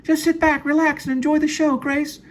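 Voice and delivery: very high falsetto woman voice; Jane's voice. Acted. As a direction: squeak it out high and sweet.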